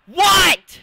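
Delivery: high, loud startled cry; shocked shout. Real, not acted. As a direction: snap out a shocked what. Startled after a gasp.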